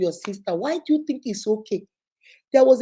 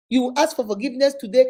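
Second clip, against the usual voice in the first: ordinary talk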